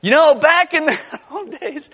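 loud, high parody breaking into a laugh; a hammed-up longing that cracks into laughter; mock nostalgia laughing. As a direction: lose it laughing mid-quote. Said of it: "You know what they said in those days?".

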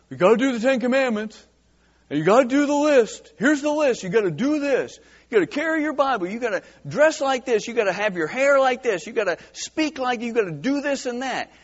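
high, rattled-off list of demands; mock legalist. Preacher voicing a legalist's list of demands, mock insistent.

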